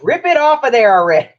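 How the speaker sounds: loud, high-pitched mock exasperation; impatient outburst. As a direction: burst out, fed up with the slowness. Impatient retort to slow crafter.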